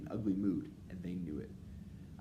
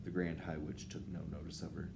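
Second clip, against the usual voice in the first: ordinary delivery, nothing marked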